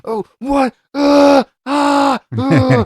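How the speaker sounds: high, loud flustered grunts; mock sputtering wrestler. Mocking the wrestler's meltdown, high spluttering grunts.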